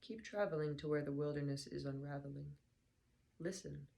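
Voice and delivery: normal talking voice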